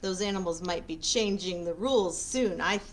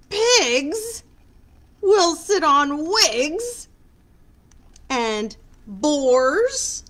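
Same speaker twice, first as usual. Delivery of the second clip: read-aloud rhyme; 8 semitones higher, 7 dB louder, much slower than usual. A narrator's reading. Reads rhyming picture book aloud.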